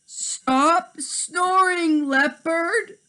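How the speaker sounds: high, very slow whine; high drawn-out plea; pleading monkey. Acted. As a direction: whine at the snoring leopard.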